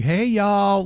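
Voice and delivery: high, loud camp greeting; mock effeminate. Host imitating co-hosts' new greeting, mock effeminate. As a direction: exaggerate it, effeminate and drawn out. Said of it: "like we're very effeminate in the way we're saying it".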